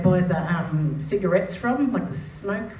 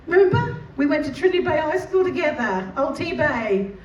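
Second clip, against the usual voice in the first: acted eager reminder; 7 semitones higher than usual. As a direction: fake old-friend enthusiasm. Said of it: "Did the best acting I could do".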